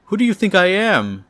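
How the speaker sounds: high, loud indignant outburst; angry rant. Retold or imitated. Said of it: "continues with his angry rant by saying".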